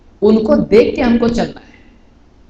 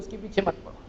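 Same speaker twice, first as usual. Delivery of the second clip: 1 faint repeat; 3 semitones lower, 18 dB quieter than usual. Retold or imitated. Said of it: "وہ بولتے ہیں".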